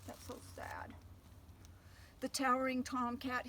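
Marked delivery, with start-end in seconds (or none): 0.1-0.9 whispered pity; soft whispered sympathy; hushed. Real, not acted. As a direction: breathe it out sadly. Aside while reading to children.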